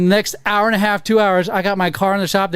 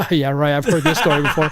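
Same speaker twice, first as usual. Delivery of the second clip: chuckling disbelief; 3 semitones lower than usual. Retold or imitated. Receptionist doubting the story, chuckling. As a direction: retell her skeptical line through a chuckle. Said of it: "And she chuckles at me like".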